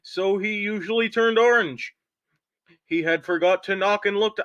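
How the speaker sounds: high, punchy anchor read; news anchor voice. Acted. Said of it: "do it in the news anchor voice".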